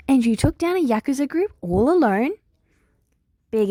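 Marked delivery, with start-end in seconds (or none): 0.1-2.3 high, louder astonished question; Nedzu amazed. Acted. Nedzu, astonished, asks Big Eri about the Yakuza. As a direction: marvel at her feat.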